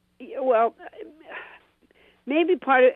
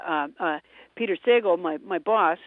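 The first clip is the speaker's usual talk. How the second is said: usual speaking voice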